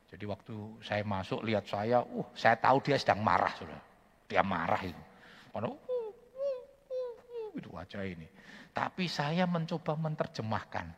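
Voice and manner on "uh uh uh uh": straining grunts. Retold or imitated. Voicing stroke patient who can't speak.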